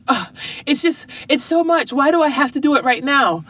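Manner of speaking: put-on high, whiny kid complaining about homework; whiny daughter. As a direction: whine like a tired sixth grader. Daughter whining about homework, retold.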